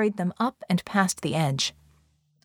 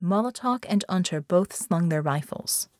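ordinary delivery, nothing marked

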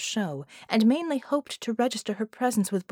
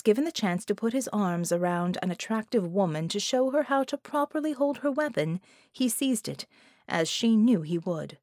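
plain reading voice

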